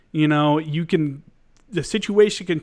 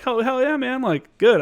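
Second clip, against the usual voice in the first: mock cheer; 9 semitones higher than usual. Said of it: "we're like".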